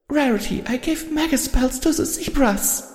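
high, far-off voice of a timid pony; small high confession. A narrator's reading. Timid pony confesses to her friend, high and small. Fluttershy's held voice.

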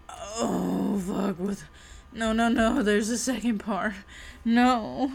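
ordinary talk

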